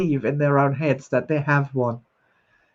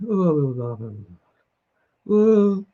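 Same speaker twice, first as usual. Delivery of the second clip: sad memory demo; 4 semitones lower than usual. Shown as a demonstration. Host demonstrating a sad memory to viewers, low deflated moan. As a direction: sink into a gloomy sound of a sad memory. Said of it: "see the difference between happy and sad".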